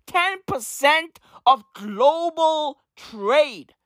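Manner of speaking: very slow, drawn out, each word weighed; slow emphatic. Shown as a demonstration. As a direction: stretch it out to make it sink in. Repeating the key figure for emphasis.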